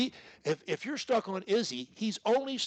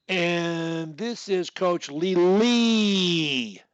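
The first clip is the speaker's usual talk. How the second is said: drawn out, louder, every word stretched; slow loud coaching demo; very slow held start; 7 dB louder, much slower than usual. Shown as a demonstration. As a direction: lean into "Lee" and hold it. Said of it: "you gotta make it a repeat start".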